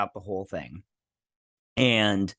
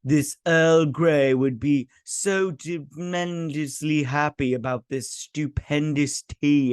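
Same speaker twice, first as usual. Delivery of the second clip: slow, higher-pitched overblown delivery; hammed-up bad acting; 3 semitones higher, slower than usual. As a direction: imitate stilted acting, drawing out the big words. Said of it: "It was very bad acting".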